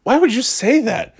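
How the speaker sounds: very high mock-scandalized mom; imitated mother. Mom hushing son over his swearing, high imitated scolding. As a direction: scold and shush in a squeaked-up voice. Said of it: "She goes".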